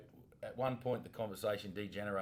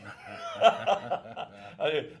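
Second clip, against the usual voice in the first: loud laugh; 11 semitones higher, 13 dB louder than usual. Real, not acted. Laughing at friend eating wings.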